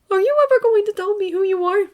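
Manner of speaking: putting on a brave face; young Izuku. A narrator's reading. Izuku reassuring All Might as he leaves. Boy voice.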